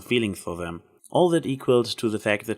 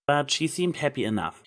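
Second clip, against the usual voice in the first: ordinary delivery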